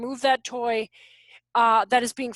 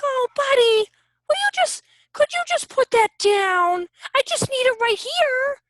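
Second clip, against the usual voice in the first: much higher, pleading and sugary; pleading mommy voice; 10 semitones higher, 4 dB louder than usual. Shown as a demonstration. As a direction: beg the kid sweetly, way up high. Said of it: "not like".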